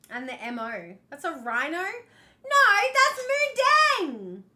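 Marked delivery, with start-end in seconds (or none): 1.8-4.4 high, loud, very slow, MU stretched; defending the hippo drawing, high and drawn out; indignant exclaim. Real, not acted. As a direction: proudly insist it's Mu Dang.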